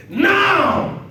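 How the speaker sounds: sudden high loud "Now!"; shouted command. Acted. Jesus calling to his disciples, shouted. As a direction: bark it out like a sudden order. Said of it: "All of a sudden he said".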